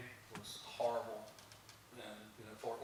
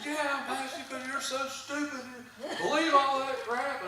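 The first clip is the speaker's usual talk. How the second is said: retold angry shout, higher and louder; imitating a yell; 12 semitones higher, 8 dB louder than usual. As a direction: play the angry man shouting back. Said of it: "screamed at me for ten minutes".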